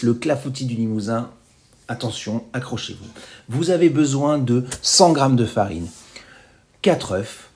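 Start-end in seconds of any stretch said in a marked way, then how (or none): 1.9-3.1 hushed, teasing build-up before an easy recipe; playful fake warning, much softer; mock suspense. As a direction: drop the voice as if something huge is coming.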